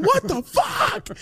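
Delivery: high slow shocked yelp; startled cry. Retold or imitated. Charlie stopped mid-sentence, yelping high in shock. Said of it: "He's like".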